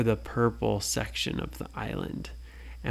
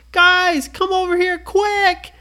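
much higher, louder call; urgent shout; 7 dB louder than usual. Acted. Brady under falling cheese, shouting. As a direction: yell for them to hurry.

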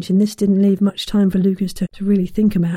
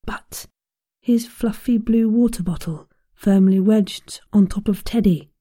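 plain reading voice

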